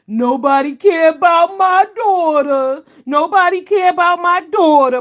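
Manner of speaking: loud, high, slow mocking outrage; mock angry whine. Mocking him complaining nobody cares about his daughter, loud and high. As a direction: wail it like a wronged father, loud and high. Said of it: "then he got mad because".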